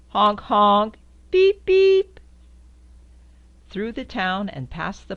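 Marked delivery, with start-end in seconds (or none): 0.1-1.0 loud, blaring honks; loud horn honk. Acted. Reading to a child, honking the jeep horn loudly. 1.3-2.2 much higher, loud tinny beeps; high squeaky beep. Acted. Reading to a child, beeping high and loud like a horn.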